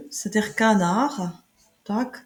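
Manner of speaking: normal talking voice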